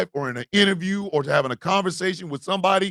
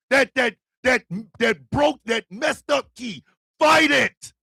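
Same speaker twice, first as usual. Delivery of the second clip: rallying shout; 5 semitones higher than usual. Real, not acted. Urging viewers not to give up, shouting and fired up. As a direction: rouse the crowd.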